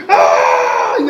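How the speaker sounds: grito grave y fuerte; grito de monstruo. Acted. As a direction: bramido ofendido. (monstruo grita grave)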